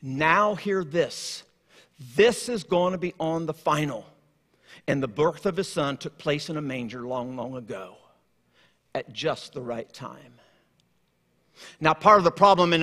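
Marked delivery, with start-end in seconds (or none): 0.0-4.0 slow, soft and grave; God addressing humankind, softer and slow; solemn announcement. Acted. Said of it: "God saying to all of humankind". 4.9-10.3 hushed. Acted. Hushed close of the story.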